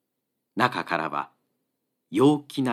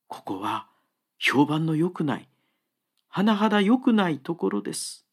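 earnest. Acted. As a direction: urge the monk to come along. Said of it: "熱心に言うので". (熱心に頼み込む木こり)

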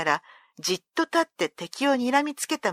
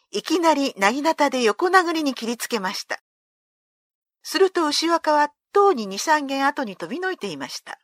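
usual speaking voice

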